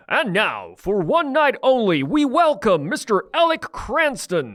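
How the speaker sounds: big, high, drawn-out announcer voice; announcer impression. Shown as a demonstration. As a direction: introduce a star like a ring announcer. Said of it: "You can do the deep announcer voice".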